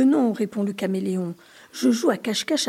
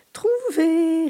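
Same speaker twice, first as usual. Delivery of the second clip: gleeful laugh; 7 semitones higher, 6 dB louder than usual. A narrator's reading. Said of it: "rigole le caméléon".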